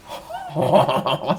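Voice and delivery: delighted burst of laughter. Real, not acted. Delighted by webcam feature.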